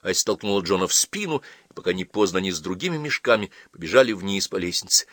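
usual speaking voice

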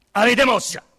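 the inspector questions the officers, loudly; sharp loud question. Acted. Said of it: "Domandò".

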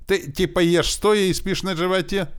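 high, loud mimicked girl's taunt; snide. A narrator's reading. Boy quoting a girl's jibe to orc, snide. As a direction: mimic her needling question. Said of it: "Она ещё так ехидненько".

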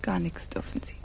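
soft threatening whisper; menacing whisper. Acted. Refusing her leave, menacing whisper. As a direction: deny her in a cold hush.

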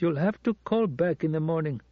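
prim night porter on the line; butler voice. Acted. Butler voice continues.

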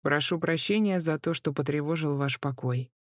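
ordinary delivery, nothing marked

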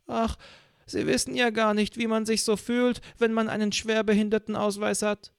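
put-on high whiny old woman; old lady voice. A narrator's reading. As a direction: play the self-pitying old lady, high-pitched.

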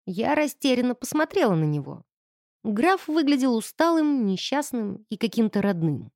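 ordinary delivery

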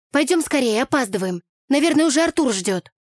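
high, pressing insistence; urgent hurrying. A narrator's reading. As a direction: hurry your friend along. Hurries her friend away, running late.

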